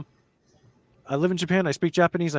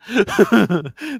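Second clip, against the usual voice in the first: high burst of laughter; 10 semitones higher, 8 dB louder than usual. Real, not acted. Laughs at the joke.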